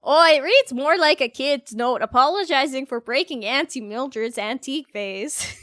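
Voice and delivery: put-on broad, lilting northern prairie accent; thick, folksy Minnesota accent; Minnesota-Saskatchewan accent. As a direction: lean hard into Minnesotan vowels. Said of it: "Minnesota and Saskatchewan".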